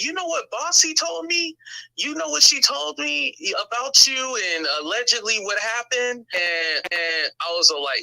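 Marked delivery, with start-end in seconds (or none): 0.0-6.2 much higher, lightly voiced gossipy friend; imitating a friend who can't wait to spill; eager gossip. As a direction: dish the dirt, pitched up high. Friend gossiping eagerly about Bossy.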